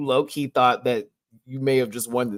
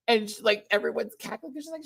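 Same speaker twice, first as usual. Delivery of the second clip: ordinary talk, nothing marked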